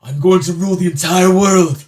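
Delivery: loud, high villain proclaiming; Vegeta. Acted. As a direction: boast like an arrogant dark lord. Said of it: "should we ask Vegeta".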